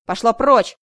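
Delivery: loud furious shout. A narrator's reading. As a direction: yell it out to drive her away. Vadim drives her away.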